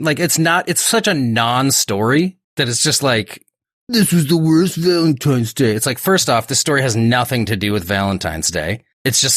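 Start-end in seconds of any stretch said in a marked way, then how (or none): 3.9-5.7 high-pitched mock whine of a complainer; exaggerated, mocking quote in a higher voice; mocking complaint. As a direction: mimic an overdramatic complainer, pitched up. Mocks the story writer's complaint.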